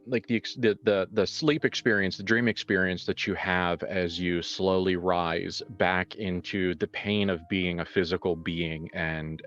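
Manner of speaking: usual speaking voice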